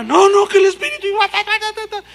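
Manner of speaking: very high, loud babbling protest; high mock tongues babble; frantic tongues. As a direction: refuse and keep prophesying. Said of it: "Entonces la hermana".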